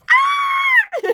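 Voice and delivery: real shriek into a laugh; startled scream. Real, not acted. Vlogger shrieking at a scary picture, then laughing. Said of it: "Oh my God, I scream like that".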